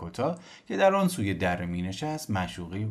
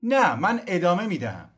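high, loud firm answer; calling down; 10 semitones higher, 8 dB louder than usual. Acted. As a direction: stand firm, call it down. Cosimo answers from tree.

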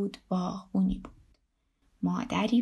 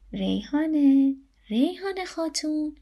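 slow, higher, drawn-out call; 6 semitones higher, 4 dB louder than usual. A narrator's reading. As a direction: call her name from a little way off. Grandfather suddenly calls out to her.

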